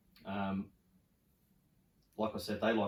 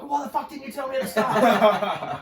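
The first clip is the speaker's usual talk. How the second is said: very high, loud, slow mock shriek through laughter; outraged girlfriend; 13 dB louder, slower than usual. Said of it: "she's like".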